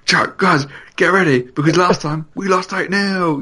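rally the squad loudly before kickoff; team talk shout. Pretend. Mock team talk before the game.

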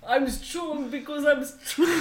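slow mock French-accented English; drawn-out bad English accent; imitated English accent. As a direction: parody a friend's laboured English accent. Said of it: "T'as dit un truc comme ça".

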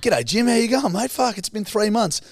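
loud, high, put-on London lad; mock London accent. Returned traveller greeting Jim, mock London accent. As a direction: greet a mate in exaggerated London-Aussie speak. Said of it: "ones that go over to London".

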